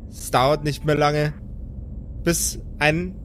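usual speaking voice

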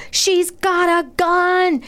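high, drawn-out mock scream; imitated scream. Resident imitating a neighbour's blowout, mock screaming. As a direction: screech it slowly, imitating the neighbour. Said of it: "one of them screamed".

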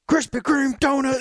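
very high squeaky mock rant; mocking the brother. Teammate mimicking a furious young driver, squeaky. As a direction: squeal it like a mad kid. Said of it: "your brother was like".

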